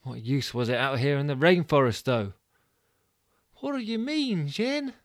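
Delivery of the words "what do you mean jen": put-on much higher, puzzled young woman; high, questioning heroine voice; Mudguard's voice. Mudguard asks Jen.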